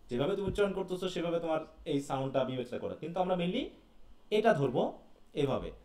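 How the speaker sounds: usual speaking voice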